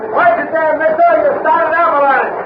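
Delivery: high, urgent holler; yelling at a careless climber to watch out; shouted warning. Acted. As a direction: call out a sharp warning from a distance. Calling a warning down the canyon.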